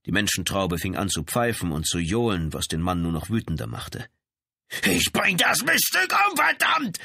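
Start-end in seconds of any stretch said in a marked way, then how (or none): 4.8-7.0 high-pitched, furious shout; roar of burning rage. A narrator's reading. Raging brother threatens the woman, bellowing furiously. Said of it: "brüllte er in glühendem Zorn".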